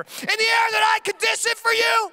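high, pompous tirade; dad ranting at his toddler over milk; mock rant. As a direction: puff up with righteous outrage.

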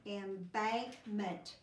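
embankment drawn out slowly syllable by syllable; teacher sounding out a long word; slow blended word. Shown as a demonstration. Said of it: "See how the words are getting longer".

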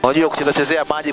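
ordinary talk

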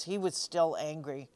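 ordinary delivery, nothing marked

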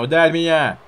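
loud taunt. Real, not acted. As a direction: yell the dare at him. Taunting opponent louder.

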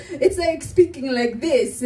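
slow, put-on Italian accent; mock the accent of Milanese Italians; imitated Italian speech. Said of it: "they're like".

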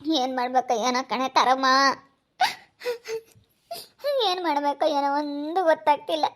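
helpless weeping lament; crying. Acted. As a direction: sob between the phrases, at a loss. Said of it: "ಯಾಕ್ aunty ಅಳ್ತಾ ಇದೀಯಾ?". (ಅಳುತ್ತಾ, ದಿಕ್ಕು ತೋಚದೆ)

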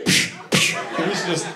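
normal talking voice

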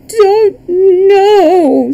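high, loud wailed sob; acted childish bawling; sobbing child. A narrator's reading. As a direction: wail it like a tearful little boy. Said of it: "sobbed the child".

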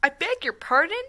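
loud, high indignant gasp of a question; mock outraged. Real, not acted. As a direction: act scandalised at the line. Reacting in mock outrage to game line.

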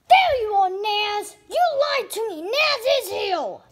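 high, loud accusing yell; angry yell at Naz for lying; angry accusation. Acted. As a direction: call out the liar.

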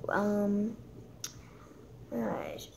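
ordinary talk, nothing marked

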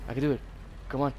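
normal talking voice

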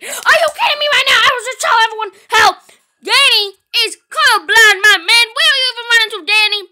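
high, loud outraged rant; exasperated yelling. Pretend. Player to Danny who grabbed the wrong key, exasperated yelling. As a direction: yell in hammed-up disbelief.

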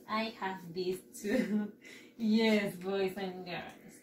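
usual speaking voice

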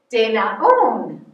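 De na un said slowly, each syllable separate; Amharic goodbye, slow and clear. Shown as a demonstration. Teacher modelling De na un slowly for children. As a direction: model De na un slowly for the kids to copy. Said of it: "let's try it".

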